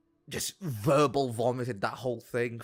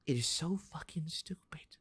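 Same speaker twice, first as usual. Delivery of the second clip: hushed, voiceless disbelief; exasperated whisper; 3 semitones higher, 7 dB quieter than usual. Real, not acted. Streamer despairing at American food tax, exasperated whisper. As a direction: whisper it as if worn out by the stupidity.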